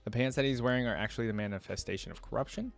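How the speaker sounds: usual speaking voice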